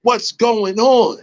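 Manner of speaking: put-on high-pitched, bewildered young voice; mock-incredulous, high retold reaction; imitated younger self. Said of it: "I'm young with it, like".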